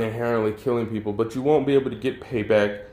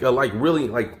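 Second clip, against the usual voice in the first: ordinary talk, nothing marked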